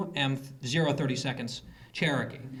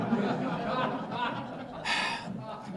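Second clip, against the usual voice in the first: laughing sigh; 8 semitones higher, 3 dB quieter than usual. Real, not acted. Laughing sigh after the joke.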